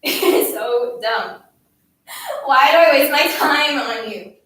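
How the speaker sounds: loud, fed-up outburst; exasperated scolding. A narrator's reading. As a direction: build from slow contempt to a loud complaint. Bully's scolding tirade peaks.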